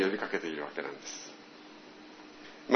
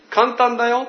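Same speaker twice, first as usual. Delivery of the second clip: high, louder, cheerful friend; friend's easy fix; 11 semitones higher, 6 dB louder than usual. Retold or imitated. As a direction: toss it off like it's nothing. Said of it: "って". (高く明るく言う友人)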